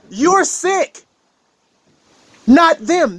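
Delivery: high, sharp verdict; scolding outburst. Real, not acted. Speaker calls out grudge-holding listeners, high and sharp. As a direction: snap the judgment up high.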